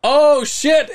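dismayed surprise. Retold or imitated. Missing the last prize.

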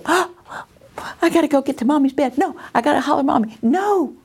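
put-on child's voice. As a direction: rattle it off like a small child correcting herself. Acting child's sleepy thoughts.

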